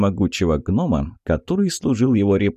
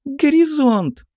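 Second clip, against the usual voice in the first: very high, drawn-out tiny squeak; elf girl's squeak; slower than usual. A narrator's reading. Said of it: "пискнула эльфийка". (тоненький писк эльфийки)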